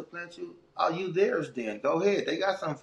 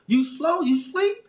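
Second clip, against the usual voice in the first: high, louder punchline; emphatic warning; 11 semitones higher, 5 dB louder, slower than usual. Retold or imitated. Father warning his son about prison life, high and loud. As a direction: hammer home the street rule. Lecturing son, street rule.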